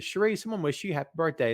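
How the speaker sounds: ordinary talk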